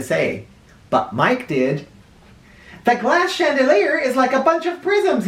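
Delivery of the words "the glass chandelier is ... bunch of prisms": yelled. A narrator's reading. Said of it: "he yelled".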